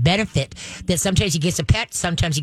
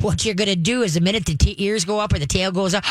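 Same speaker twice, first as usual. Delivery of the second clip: normal talking voice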